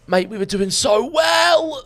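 high, loud, drawn-out yell of despair; frustrated shout. Real, not acted. Gamer shouting at his teammate in frustration. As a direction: cry out that the run is ruined.

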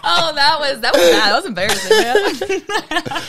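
high, loud words through laughter; real laughing. Real, not acted. Guest reacting to an embarrassing slip, laughing loudly.

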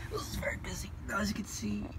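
hushed unvoiced whisper. Real, not acted. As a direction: whisper it so nobody hears. Filming quietly in busy place.

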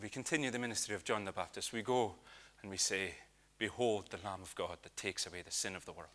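put-on low, soft Hebridean accent drifting into Irish; attempted Lewis accent, slipping Irish. Old minister explains his calling to John, wobbly Lewis accent. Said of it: "definitely gone Irish".

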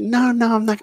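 put-on high, small voice of a shy little girl; lightly voiced little girl saying no. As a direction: play a five-year-old refusing. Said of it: "She's like".

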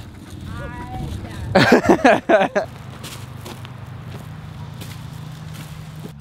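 wheezing, helpless laughter after getting soaked; long breathless laugh. Real, not acted. Soaked by park sprinklers, cracking up at himself: long helpless laugh. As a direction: laugh it out, breath running away with you.

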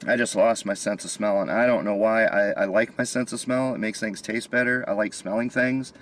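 normal talking voice

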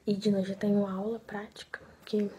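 quiet. Real, not acted. Speaks very quietly.

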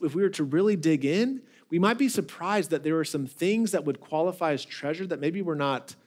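ordinary delivery

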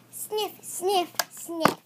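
spoken sniff sniff sniff; sniffing the air. Pretend. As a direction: sniff like a curious dog. Dog sniffing spoken aloud.

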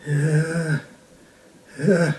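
drawn-out, pondering yes; thoughtful. A narrator's reading. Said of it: "sagte der Luchs nachdenklich".

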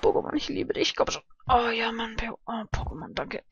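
soft whispered pleading; soft tense whisper. Real, not acted. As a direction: beg quietly.

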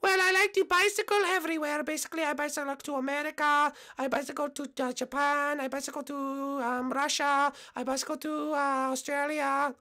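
high falsetto, listing proudly; held high voice, halting between items; cyclist contestant. Acted. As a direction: talk up your travels in a girlish falsetto.

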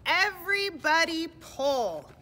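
high, loud, drawn-out call; big storybook heave-ho; rallying shout. Acted. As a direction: holler to the whole team, stretching the words. All stuck, calling to pull.

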